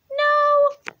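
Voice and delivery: high loud flat refusal. Pretend. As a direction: shout the no. Refusing request to stop reading.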